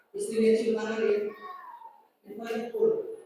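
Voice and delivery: usual speaking voice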